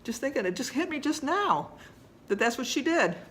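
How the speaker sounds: fighting back tears as it hits her; choked up, close to crying; voice breaking. Real, not acted. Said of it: "I'm gonna cry".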